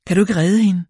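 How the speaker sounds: woman begging the paramedic, frightened and pleading; shaky pleading. A narrator's reading. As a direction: beg the paramedic to save her. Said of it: "sagde kvinden med rystende stemme".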